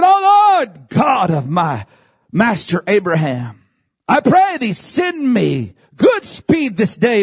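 cried-out prayer, leaping high then settling; fervent scripture declamation. Acted. Preacher voicing the servant's prayer to God, fervent declamation. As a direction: proclaim it slowly and high, dropping between phrases.

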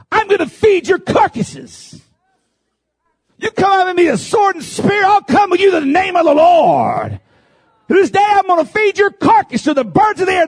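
high, loud, impassioned preacher's cry; full-force preaching. Acted. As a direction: preach it out to the congregation with fire. David's threat preached at full force.

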